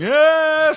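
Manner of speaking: high loud mock scream; scream of panic. Man covered in centipedes, mock scream. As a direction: yelp like centipedes are on you. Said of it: "he starts going".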